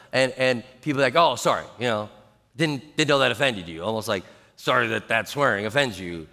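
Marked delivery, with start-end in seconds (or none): 1.2-6.3 mock apology in a low voice; awkward apology. Swearer to the pastor ten years later, awkward apology. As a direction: drop low for a half-hearted sorry.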